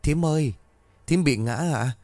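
a touch of concern in the question; worried asking. A narrator's reading. Girl runs to fallen aunt, mildly worried asking. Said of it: "lo lắng hỏi".

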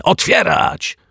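high, loud bark of a command; angry neighbour yells at the bolted door. Acted. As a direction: yell it at the locked door. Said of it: "wrzasnął".

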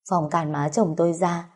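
plain reading voice

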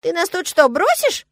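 high, loud squirrel dismay; squeal, theatrically appalled; dramatic gasp. A narrator's reading. Squirrel fears being left behind, melodramatic gasp. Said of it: "ахнул он".

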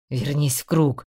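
low growled command; growled order. A narrator's reading. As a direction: snarl it at her. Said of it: "прорычал он". (рычащий приказ)

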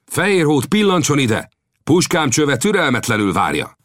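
high, challenging call from a distance; ringing call. A narrator's reading. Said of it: "komancsok mögött felcsendült egy hang".

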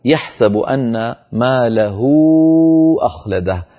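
slow chanted recitation of the verse; slow melodic Quran recitation. Shown as a demonstration. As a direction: recite it like tajweed. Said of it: "كقوله تعالى".

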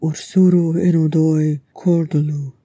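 ordinary delivery, nothing marked

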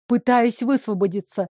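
ordinary delivery

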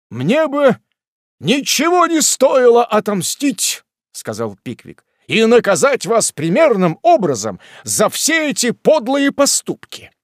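high, loud righteous indignation; high loud righteous rebuke; noble anger. A narrator's reading. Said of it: "пылая благородным гневом".

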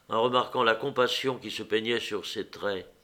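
plain reading voice

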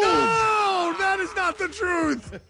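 very high, drawn-out cry of denial; mock outraged protest. Radio host answering the Satan clip, high mock protest. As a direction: wail the denial in hammed-up horror.

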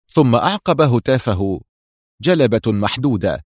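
ordinary delivery, nothing marked

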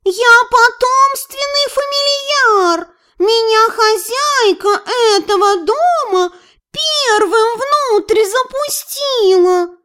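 high, slow, drawn-out cat wail; indignant yowling cat. A narrator's reading. Cat boasts to the house spirit, indignant yowl. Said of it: "возмущённый вопль Фили".

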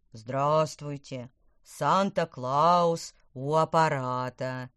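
slow, booming deep voice; deep bass. Acted. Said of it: "сказал он густым басом".

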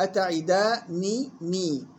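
أتعدانني very slow, split "-daa, ni, nee"; both nuns kasra. Shown as a demonstration. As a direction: separate the two nuns of أتعدانني. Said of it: "كسر النون الأولى والثانية". (أتعدانني مقطّعة بكسر النونين)

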